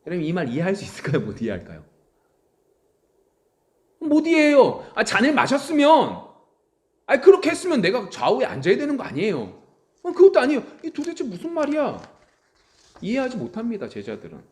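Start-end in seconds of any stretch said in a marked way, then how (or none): 4.0-4.8 laughing, high and loud. Real, not acted. Laughing exclamation. 4.9-12.0 high, loud baffled protest; confused disciples. Acted.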